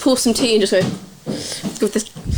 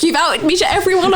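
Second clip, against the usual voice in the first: higher, lightly voiced hurt man; a hint of a tearful accusation; upset Dan; 6 semitones higher than usual. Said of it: "He was like".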